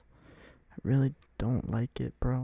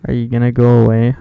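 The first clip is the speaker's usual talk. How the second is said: calling out; 11 dB louder than usual. Real, not acted. Calling out to mom.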